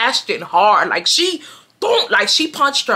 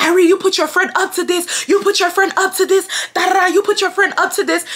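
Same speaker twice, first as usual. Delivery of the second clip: mock scream; 10 semitones higher than usual. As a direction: shriek the accusation at Irene like the crying girl did. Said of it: "she starts screaming at me".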